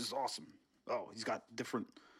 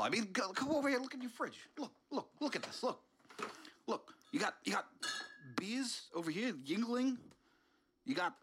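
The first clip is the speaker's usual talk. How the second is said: higher, hurried deflecting; flustered; 8 semitones higher than usual. Acted. Intruder distracting the host with the fridge, higher and flustered. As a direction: babble to change the subject.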